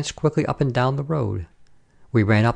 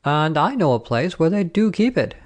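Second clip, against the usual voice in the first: higher-pitched, knowing soldier; blue-eyed soldier; 7 semitones higher than usual. A narrator's reading. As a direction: offer it with a lift in pitch. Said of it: "said the blue-eyed soldier".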